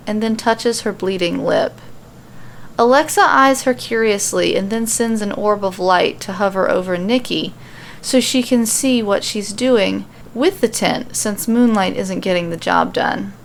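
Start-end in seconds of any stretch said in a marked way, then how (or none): none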